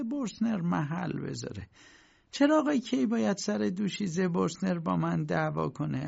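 high-pitched landlady justifying herself; landlady character, aggrieved. A narrator's reading. Landlady defending her words to K, high aggrieved voice.

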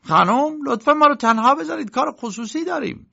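high, irritated dismissal; annoyed uncle. A narrator's reading. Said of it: "انگار از دست لنی عصبانی باشد". (عموی عصبانی با صدای زیر)

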